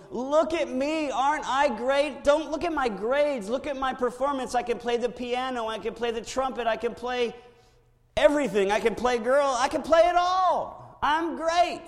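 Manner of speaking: high, loud, boastful show-off voice; mock bragging. Preacher mocks a proud believer showing off to God, high and loud. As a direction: brag like a kid fishing for praise. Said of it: "you and I going".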